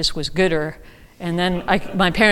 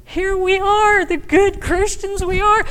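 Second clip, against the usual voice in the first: higher, mock-pious good Christians; sarcastic; 11 semitones higher, 4 dB louder, slower than usual. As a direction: send up smug believers, higher. Sarcastic self-mockery of believers.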